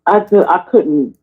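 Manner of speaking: normal talking voice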